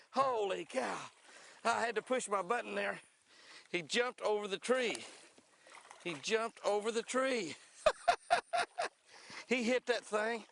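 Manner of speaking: reliving the jump, grinning; excited. Real, not acted.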